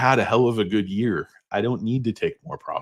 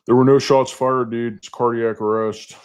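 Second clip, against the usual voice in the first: Walter Sobchak impression; 3 semitones higher, 5 dB louder than usual. Walter assuring the dude nobody was shot, forceful impression. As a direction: insist on it forcefully, pitched up. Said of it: "Quote the great Walter Sobchak".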